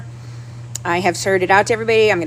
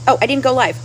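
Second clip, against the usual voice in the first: usual speaking voice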